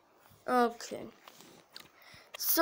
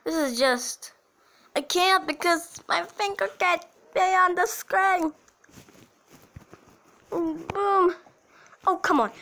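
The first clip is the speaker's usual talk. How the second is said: ordinary delivery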